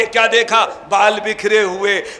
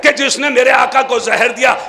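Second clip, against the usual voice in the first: ordinary talk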